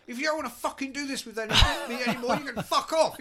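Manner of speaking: furious, high and loud; angry shout. Retold or imitated. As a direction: yell it at him in a rage. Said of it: "he goes to me".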